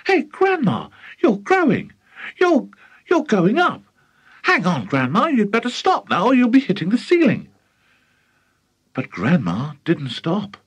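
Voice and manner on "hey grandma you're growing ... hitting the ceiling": put-on high, alarmed boy's cry; startled shout. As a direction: call out in shock as she grows. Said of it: "gave a jump and shouted".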